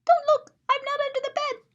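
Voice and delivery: high pleading little bear voice; frightened little bear. Acted. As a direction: squeak it like a scared bear hiding. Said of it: "and he's like".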